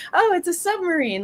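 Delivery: put-on high, gushing child's voice; excited little kid. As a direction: gasp at it like a wide-eyed kid. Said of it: "they're like".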